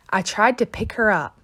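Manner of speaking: usual speaking voice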